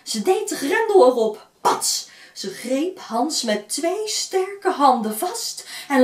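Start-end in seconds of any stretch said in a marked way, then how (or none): 1.6-2.1 sharp clap of a word; loud pats. A narrator's reading. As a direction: snap it out. Sound effect in story.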